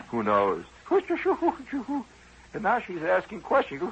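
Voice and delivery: usual speaking voice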